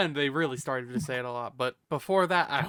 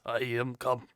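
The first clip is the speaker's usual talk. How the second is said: drawn-out character voice; "I am come" voice; 2 semitones lower, slower than usual. Shown as a demonstration. Streamer does the catchphrase a friend asked for, in character voice. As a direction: say it in the put-on character voice. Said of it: "say that in your I am come voice".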